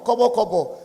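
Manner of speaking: ordinary talk, nothing marked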